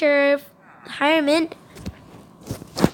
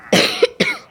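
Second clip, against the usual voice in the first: real cough. Real, not acted. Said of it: "Sorry for my coughing".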